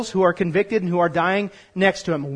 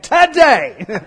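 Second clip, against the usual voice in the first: Today with sass and a rising end; punchy Today for the crowd; with attitude; 4 semitones higher, 6 dB louder than usual. Shown as a demonstration. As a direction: say Today like you mean it. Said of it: "you gotta say it with attitude".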